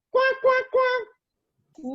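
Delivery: high, nasal-free cartoon quacking; duck quacks. Teacher giving the class a clue, high duck quacks.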